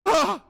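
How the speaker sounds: short, high, loud yelp of fright; fearful yelp. Acted. Scrooge as Marley's ghost appears, fearful yelp. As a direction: yelp in sudden terror. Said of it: "yelps in fear".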